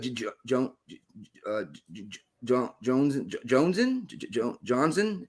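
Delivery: halting, stammering mispronunciation; stumbling over a name. Retold or imitated. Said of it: "he was like".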